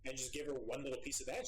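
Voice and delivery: normal talking voice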